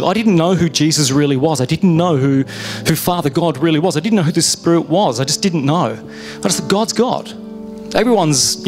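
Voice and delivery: normal talking voice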